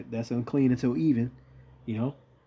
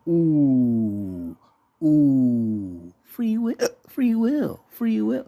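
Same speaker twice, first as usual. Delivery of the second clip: mocking sing-song; 2 semitones higher, 4 dB louder, much slower than usual. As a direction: tease like a schoolyard chant. Mocking believers in free will.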